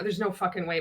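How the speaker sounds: ordinary talk, nothing marked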